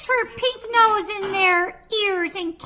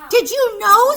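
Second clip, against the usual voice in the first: said loud and out loud, not whispered; full voice; 3 semitones higher, 10 dB louder than usual. Acted. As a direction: ask it at full volume. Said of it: "whispering now".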